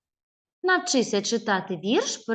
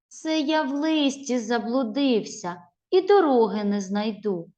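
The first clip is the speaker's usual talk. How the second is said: put-on lively, louder dialogue poem for children; expressive poem reading; 4 semitones higher, 3 dB louder than usual. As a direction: read it with feeling, like a story. Teacher reads poem expressively.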